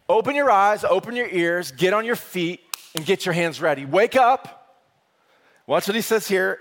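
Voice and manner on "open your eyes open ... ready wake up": high loud wake-up command; rally them awake, loud; rousing call. Retold or imitated. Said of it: "It's like".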